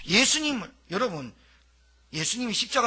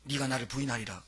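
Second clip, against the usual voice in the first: much softer, low murmur of 네가 나를 부인하리라; hushed prophecy; 8 semitones lower, 9 dB quieter than usual. Retold or imitated. Preacher repeating Jesus' warning to Peter, hushed. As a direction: quietly repeat it as if to oneself, soft on 네가. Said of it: "가기 전날 뭐라 했는지 압니까".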